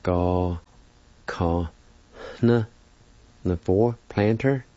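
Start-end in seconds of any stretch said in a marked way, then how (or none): 0.0-2.7 separated syllables for learners, pausing after each. Shown as a demonstration. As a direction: slowly model each syllable, "hna" nasalized. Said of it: "Once again, it's pronounced like this".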